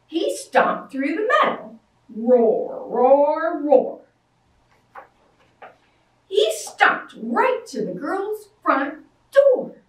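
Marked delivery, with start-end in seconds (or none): 2.1-4.1 loud growled roars; bear roar. A narrator's reading. Bear stomping down the mountain, loud roaring.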